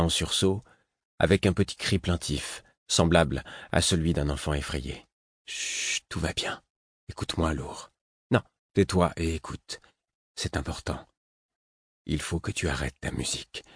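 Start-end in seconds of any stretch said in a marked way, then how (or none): 5.5-13.6 chuchoté, presque sans voix; chuchotement. Acted. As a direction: murmure pressé pour ne pas être entendu. Réveille Lourd en secret. (chuchoté à voix basse)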